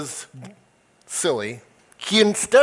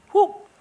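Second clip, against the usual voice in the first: whoop. Pretend. Sudden cartoon swerve in story.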